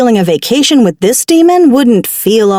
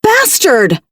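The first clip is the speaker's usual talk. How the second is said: angry outburst; 7 semitones higher than usual. Acted. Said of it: "I cried".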